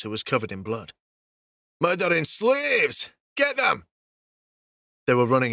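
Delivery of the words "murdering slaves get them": high, slow then shouted; angry shout. Acted. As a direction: call the attack. Armored dwarves spot them.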